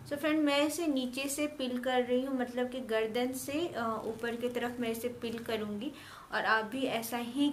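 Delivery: stiff mask-covered lips; talking while peeling mask; with dried face mask on. Real, not acted.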